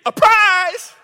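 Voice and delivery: put-on high, loud child's demand; demanding grandson. Grandfather imitating grandson asking for a prize, high loud demand. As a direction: beg for it like an eager little boy. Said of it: "or if he's saying".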